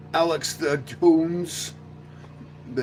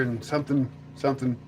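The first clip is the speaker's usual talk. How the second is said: usual speaking voice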